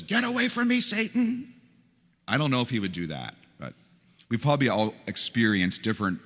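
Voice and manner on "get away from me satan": much higher, a sharp shouted rebuke; unloading rebuke. Pretend. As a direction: let it all out at him. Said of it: "Jesus unloaded and says".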